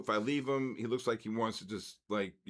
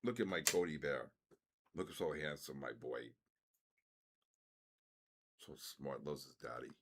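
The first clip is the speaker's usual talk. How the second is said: doting pet talk; 9 semitones lower, 9 dB quieter than usual. Real, not acted. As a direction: murmur lovingly to your pet. Doting on his dog on screen.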